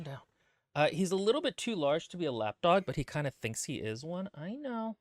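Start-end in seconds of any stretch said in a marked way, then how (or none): 4.3-5.0 softer, higher pet voice; cooing to dog. Real, not acted. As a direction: coo tenderly at the pet.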